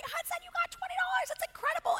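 very high, squealed game-show excitement without real volume; small high-pitched mock scream; mock excited screaming. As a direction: imitate parents squealing over a big prize. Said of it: "we are yelling and screaming like it's The Price is Right".

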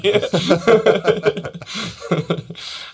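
long high laugh fading down. Real, not acted. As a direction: laugh freely, then fade. Laughs about Boston accent.

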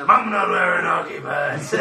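hammed-up Batman through laughter; Batman quote, laughing. Reminding friends of the Batman scene, laughing. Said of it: "it's the bit when the".

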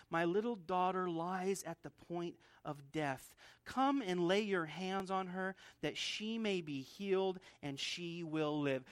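a hushed first reading of the father's words; quiet reading. Shown as a demonstration. Jairus's plea first read softly to the congregation. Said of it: "But note the confidence that he says".

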